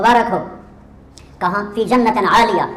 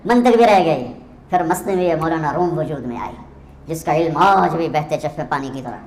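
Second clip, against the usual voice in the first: ordinary delivery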